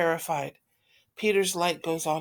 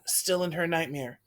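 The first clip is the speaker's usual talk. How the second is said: plain reading voice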